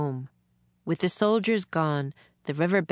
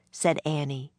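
plain reading voice

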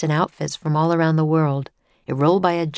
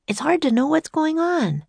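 put-on higher, brighter little girl; 10 semitones higher than usual. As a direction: lift into a young girl's voice. Annie's voice in reading.

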